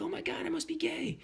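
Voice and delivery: tiny, very high mock squeal; retold freak-out in a squeaky pitch; going crazy. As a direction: imitate your frantic realization, soft but sky-high. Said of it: "So I went kinda crazy".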